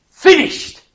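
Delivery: Finished shouted high and loud; cried out. Acted. Jesus on the cross, crying out his last word in a loud, high shout. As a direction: cry it out in triumph. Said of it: "cried out".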